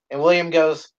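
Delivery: ordinary delivery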